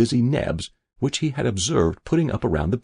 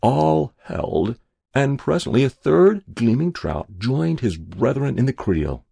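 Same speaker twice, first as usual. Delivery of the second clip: plain reading voice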